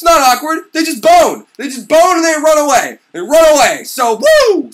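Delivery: loud, high, incredulous yelling; outraged shout. Real, not acted. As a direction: shout in comic disbelief. Comic outburst.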